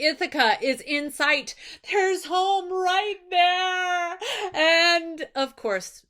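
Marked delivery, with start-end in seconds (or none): 1.9-4.2 very slow, high, longing sigh of relief; dreamy relief. Retold or imitated. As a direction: stretch it out slowly, gazing at home. Odysseus sees Ithaca.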